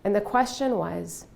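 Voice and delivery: ordinary talk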